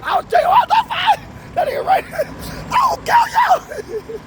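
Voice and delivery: squealing threats through laughter; high laughing shout. Retold or imitated. Laughing while retelling the threat to the boy. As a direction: yell it while cracking up.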